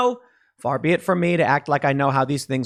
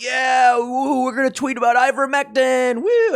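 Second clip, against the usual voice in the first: higher, shouted woo-hoo celebration; mock cheering; 9 semitones higher than usual. Right-wingers cheering Musk's Twitter buy, mock whooping. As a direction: whoop like a rowdy fan.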